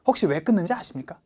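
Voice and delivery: ordinary talk, nothing marked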